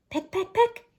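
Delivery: sparrow pecking. Acted. Sparrow voiced in story.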